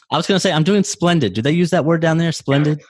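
ordinary delivery

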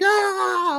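very high imitated fan scream; acted-out excited shriek; freaking-out scream. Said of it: "I was freaking out".

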